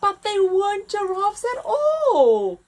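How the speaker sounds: lively picture book read-aloud; story narration. A narrator's reading. Narrator reading to children, lively.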